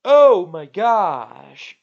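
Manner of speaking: loud, high, hammed-up dismay; mock melodramatic alarm. Streamer reacting to the plan to destroy Rex, hammed-up alarm. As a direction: wail it like a fake damsel in distress.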